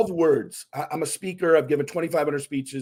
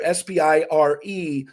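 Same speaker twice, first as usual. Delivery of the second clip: ordinary talk, nothing marked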